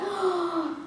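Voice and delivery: high, soft intake of breath; overwhelmed gasp. Real, not acted. Unboxer overwhelmed by gifts, delighted gasp. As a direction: gasp in happy disbelief.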